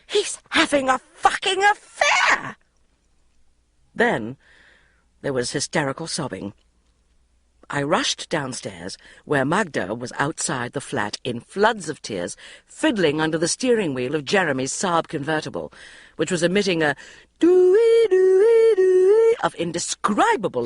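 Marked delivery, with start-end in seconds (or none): 0.1-2.6 high, loud, posh bleat of outrage; posh bleating shout. Acted. Magda over the intercom, posh high bleating shout. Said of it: "A V posh sheep voice bleated". 17.4-19.4 high loud wailing doo-ee; car alarm imitation. Narrator mimicking Jeremy's car alarm, high and loud.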